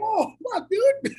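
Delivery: high-pitched jolt of surprise; startled reaction. Retold or imitated. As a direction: react as if caught off guard. Acted startled reaction to the fan.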